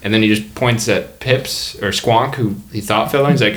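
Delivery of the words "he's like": ordinary talk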